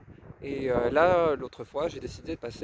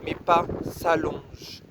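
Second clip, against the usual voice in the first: poème déclamé, posé. Real, not acted. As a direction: set each short phrase down deliberately, letting silence follow. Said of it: "les mots posés". (poème lu lentement, mots posés)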